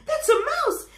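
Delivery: high, loud frightened cry; panicked. Acted. Elephant spotting the mouse, high panicked cry. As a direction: panic at the sight of it.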